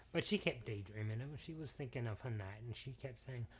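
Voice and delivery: ordinary delivery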